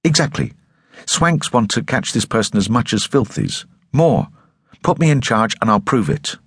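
high, insistent defiance; defiant. Acted. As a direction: push back stubbornly. Gilabeth argues defiantly.

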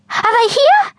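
can't wait to know; eager. A narrator's reading. Eager girl asking the man at the door. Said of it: "asked Bindi eagerly".

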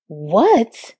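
mildly angry one-word question; getting pissed. A narrator's reading. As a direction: throw it back, starting to get angry. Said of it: "getting pissed now".

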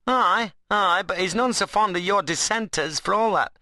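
high, goading rustic dialect; Ben, cheeky workman. Acted. Ben needles Adam about dissenters, teasingly. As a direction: play a workman scoring a point. Ben's figure voice.

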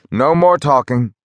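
rally the men back to work; cried out. A narrator's reading. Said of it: "cried Mark".

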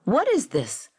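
irritated, snarly comeback; snarled. A narrator's reading. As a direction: snarl the question back. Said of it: "Gold snarled".

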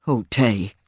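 low, sorrowful king voice; grieving king. Acted. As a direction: breathe out her name in grief. King grieves before wife's ghost.

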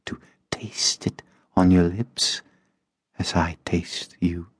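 breathy whispered temptation; seductive whisper. A narrator's reading. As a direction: whisper it against his lips.

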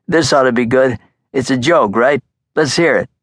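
mocking fat gangster voice; amused Brooklyn mobster. Marcosa again.

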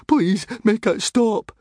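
high, slow desperate plea; frightened high-pitched prayer; begging. A narrator's reading. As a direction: plead for it to end, drawn out. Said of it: "begged Fishlegs".

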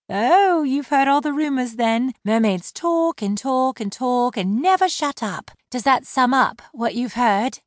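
high-voiced mermaid mocking the rumors; sarcastic mermaid. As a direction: tease him for his prejudice. Laurel sarcastic.

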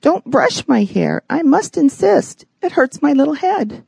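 put-on a spoiled child whining, pushing harder; bossy little princess. A narrator's reading. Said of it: "brash Henrietta said".

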